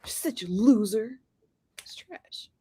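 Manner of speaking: "loser" whispered, breathy and unvoiced. Shown as a demonstration. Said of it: "say it one more time".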